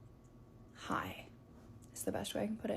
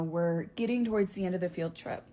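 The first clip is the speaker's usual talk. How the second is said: ordinary talk, nothing marked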